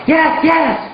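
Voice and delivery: high, eager shouted yes; mock student shout. Class shouting answers to the teacher, high and eager. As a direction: shout it like a keen pupil. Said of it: "And you shout".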